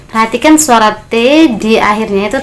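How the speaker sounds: ordinary talk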